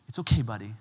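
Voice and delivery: put-on low, gentle soothing of a child; encouraging dad. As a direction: reassure a fussy little kid. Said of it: "you try the encouragement way first".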